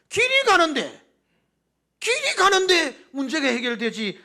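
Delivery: rising, pleading pulpit voice; impassioned sermon delivery; fervent preaching. Acted. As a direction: preach it with swelling fervor.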